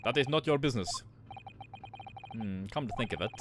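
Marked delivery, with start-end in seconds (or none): 0.0-0.9 put-on blunt Russian-accented refusal; curt Russian accent. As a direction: shut them down in a Russian accent.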